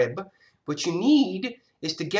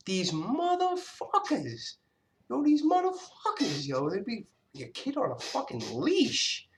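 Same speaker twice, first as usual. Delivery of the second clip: mocking voice; 6 semitones higher, 4 dB quieter than usual. Mocking leash parents.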